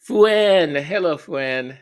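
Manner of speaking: very high, loud greeting of a name; calling out. Real, not acted. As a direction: sing the name out with a big swoop.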